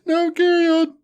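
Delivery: laughing. Real, not acted. Can't stop laughing.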